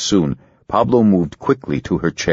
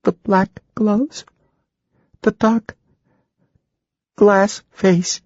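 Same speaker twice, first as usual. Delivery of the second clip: much higher, slow, dread-filled; terrified girl; slower than usual. Acted. Ginger in trance, high and terrified. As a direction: whisper the horror she sees.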